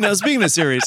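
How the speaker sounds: mock smooth host cracking up; happy segue, blown. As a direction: deliver the segue and lose it laughing. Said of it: "The guy who, who is really happy with his segue, but then he blows it with a laugh".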